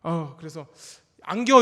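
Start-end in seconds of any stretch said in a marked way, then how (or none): 1.2-1.6 put-on calling the baby. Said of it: "이러면은".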